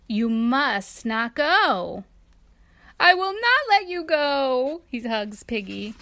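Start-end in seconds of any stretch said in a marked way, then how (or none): 0.1-4.8 slow, then loud and high refusal; beg, then hold on tight; pleading elephant. A narrator's reading.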